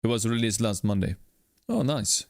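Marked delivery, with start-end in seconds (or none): none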